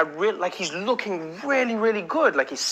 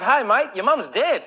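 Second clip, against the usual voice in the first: put-on cheery, higher-pitched Australian accent; 6 semitones higher than usual. Comic showing how Australians break bad news, happy Australian accent. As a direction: deliver awful news like a chirpy Australian. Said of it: "even if an Australian gave you bad".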